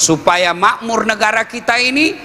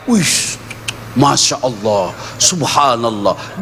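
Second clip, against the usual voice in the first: mock awed murmur, slow and lower; a lightly voiced wide-eyed cleric; awed ulama; 6 semitones lower, slower than usual. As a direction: act out a cleric dazzled by a rich home. Preacher mimics ulama admiring rich house.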